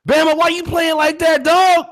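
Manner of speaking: very high-pitched complaint; mock protest. Real, not acted. Co-host protesting to a prank caller, high mock protest. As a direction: plead up high in comic protest.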